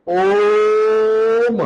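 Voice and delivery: ओम as one long, steady held ओ closing on म; प्लुत stretched vowel. Shown as a demonstration. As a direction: sustain the ओ of ओम like a level chant. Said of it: "इसको कैसे पढ़ेंगे हम इसको". (ओम का ओ देर तक एक सुर में खींचकर)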